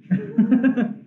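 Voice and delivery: quick high giggle; short amused laugh. Real, not acted. Laughs at fancy oven.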